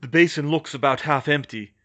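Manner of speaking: mildly distressed report; pained and scared. A narrator's reading. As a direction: report back with a touch of fear. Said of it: "Harry sounded pained and scared himself".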